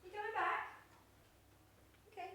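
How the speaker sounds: high calling question to the cat; pet talk. Real, not acted. Owner calls her cat Pochi back, high coo. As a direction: call the kitten back sweetly.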